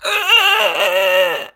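high strangled goose squawk. Shown as a demonstration. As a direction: squawk like a goose being throttled. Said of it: "it would sound like this".

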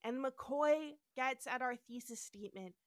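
usual speaking voice

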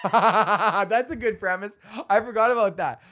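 amused by his own forgotten premise; laughing while talking. Real, not acted. Comic rediscovering an old bit, laughing.

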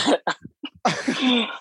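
high-pitched leftover laughter; high giggling burst; real laughter. Real, not acted. Both still laughing at the story.

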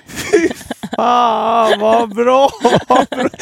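high, loud joyful cry; overjoyed relief. Retold or imitated. Telling the ex the news, high and loud with joy. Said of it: "jag blev skitglad".

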